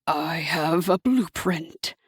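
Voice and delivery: low, slow, gloating; low devil voice. A narrator's reading. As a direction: savour the words. Hasatan's voice.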